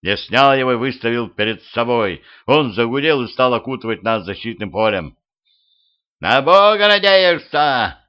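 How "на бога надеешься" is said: high, loud witchy screech; furious drawn-out shriek. A narrator's reading. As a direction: squeal it out, stretching the words. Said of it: "взвизгнула ведьма".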